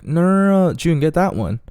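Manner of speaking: high, loud mock objection; girl's quick protest, acted out; imitating the girl.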